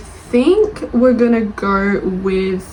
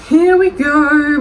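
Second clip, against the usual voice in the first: higher, louder, slow sing-song; drawn-out here we go; 7 semitones higher, 5 dB louder, much slower than usual. Real, not acted. Vlogger starts the next one-star review, drawn-out sing-song. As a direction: stretch it out like a fanfare.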